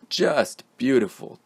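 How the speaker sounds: Just hit firmly with beautiful; JUST beautiful, both words stressed. Shown as a demonstration. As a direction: lean on Just and beautiful together. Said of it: "we stress the word just along with the adjective".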